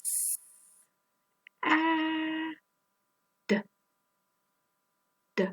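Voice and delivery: S ah D each sound alone. Shown as a demonstration. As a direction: break sad into separate sounds. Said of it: "let's say the sounds again".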